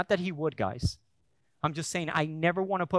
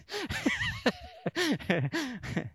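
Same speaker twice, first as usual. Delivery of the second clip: high laugh; 14 semitones higher, 4 dB quieter than usual. Real, not acted. Laughs at own awkwardness.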